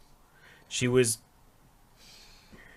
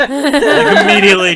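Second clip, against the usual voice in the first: loud, higher laughing burst; 11 semitones higher, 17 dB louder than usual. Real, not acted. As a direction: laugh out the word. Retelling instant Alec Guinness answer.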